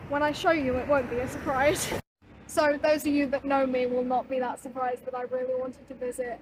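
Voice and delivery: projecting over a noisy place. Real, not acted. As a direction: speak up over the noise. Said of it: "have to raise my voice".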